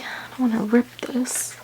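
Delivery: careful little whisper; hushed worry about ripping the card. Real, not acted. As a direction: whisper it while handling something fragile. Careful unboxing, afraid to tear.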